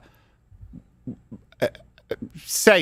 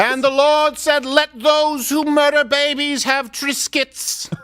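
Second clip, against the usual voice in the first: slow, grand scripture voice; booming biblical narration; 11 semitones higher, much slower than usual. Pretend. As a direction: proclaim it like holy writ. Mock scripture.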